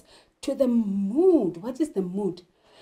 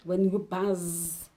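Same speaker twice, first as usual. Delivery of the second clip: ordinary talk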